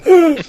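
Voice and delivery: high startled yelp of fear; whimpering flinch. Acted. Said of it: "He's like".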